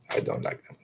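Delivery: soft voiceless whisper; whispered aside. Real, not acted. Fan whispers his real dislike of Ewoks. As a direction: confess it in a whisper.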